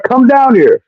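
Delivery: high, agitated retold call; outraged calling. Telling Sandy to come see the woman in his bed, high and outraged.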